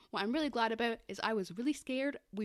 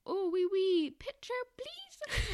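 high, hammed-up French accent; mock French accent; 8 semitones higher, much slower than usual. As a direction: do a cartoon French guy, drawing out the last word. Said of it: "they meet these French guys".

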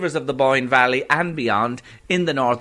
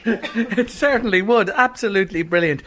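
laughing; 7 semitones higher than usual. Real, not acted. Host laughing while agreeing.

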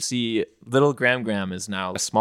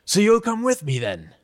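higher, eager question; hopeful stepfather; 11 semitones higher, 5 dB louder than usual. Acted. Stepfather eagerly asking Zula to join, higher. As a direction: ask her along hopefully.

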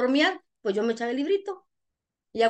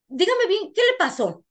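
higher-pitched imitation of a probing señora; señora inquisitiva; 7 semitones higher, 3 dB louder, slower than usual. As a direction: press for the full story, voice lifted. Imita a la señora que pregunta. (imitando a la señora que pregunta, voz aguda)

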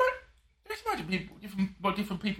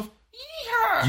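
high, slow drawn-out yeehaw; a self-confessed bad cowboy whoop; mock cowboy yeehaw; 4 dB louder than usual. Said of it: "I'm not that good of accents".

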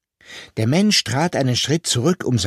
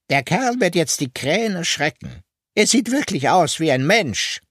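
higher, pointed voice, satisfied with the work; sharp high voice; 6 semitones higher than usual. A narrator's reading. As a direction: lift it up and sound pleased. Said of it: "der Mensch mit der spitzen Stimme".

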